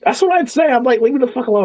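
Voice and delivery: way up high, fed-up squeak; exasperated high pitch. Retold or imitated. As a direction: squeal it like you're done with everyone. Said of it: "exasperated retelling".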